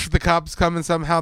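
usual speaking voice

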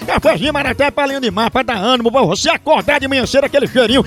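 upbeat radio ad pitch for the coffee; coffee ad read. Acted. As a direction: sell the coffee like a live radio spot. Host reads coffee ad. (leitura de comercial animada)

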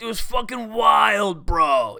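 loud, drawn-out stoked bro shout; Dean Del Rey. Acted. Hustler friend raving, imitated slow bro shout. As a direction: stretch it out slow and loud like a hyped buddy. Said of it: "You know Dean's voice".

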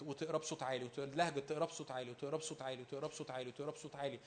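low, soft repetition, like murmuring over a text; repetitive meditative reciting. Shown as a demonstration. Preacher showing how to meditate on Scripture, soft repetitive murmur. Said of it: "تلهج وتقرا وتلهج".

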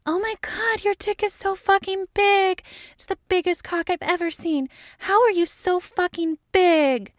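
very high put-on squeal; hammed-up ecstasy. A narrator's reading. Dana flattering Mulder through the wall, hammed-up ecstasy.

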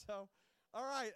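normal talking voice

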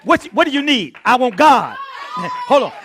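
high, loud, urgent preacher's cry; full-force preaching. Real, not acted. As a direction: cry it out to the congregation.

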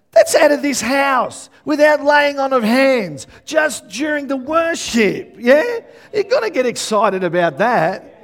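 high, loud, drawn-out proclamation; full-force preaching. Real, not acted. Preacher urging the congregation to celebrate healing, exultant. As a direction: shout it out to rouse the congregation, stretching the words.

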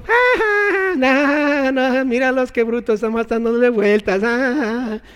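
high jeering laugh, easing down; mocking soldiers. Wall guards laughing at marching Israelites, mock jeering laugh. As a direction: scoff at the fools below. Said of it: "Y ahí los soldados del muro".

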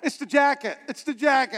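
usual speaking voice

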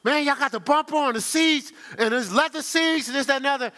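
high, loud amazed complaint; bursting out at the dealership; worked-up retelling of himself; excited complaint. Retold or imitated. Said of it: "I was like".

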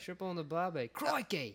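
mock Australian accent. Mocking Australians after accent talk.

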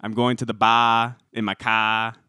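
quick demo of a Boston accent. Shown as a demonstration. Host showing how easy it is, Boston accent. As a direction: drop the R's like a Bostonian. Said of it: "Boston is supposed to be easy".

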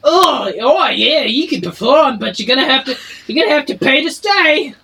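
high, loud barking tavern keeper; a barkeep shouting from behind the bar; dwarf barkeep. Acted. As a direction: bark orders at the kitchen staff. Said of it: "His voice does not match his description".

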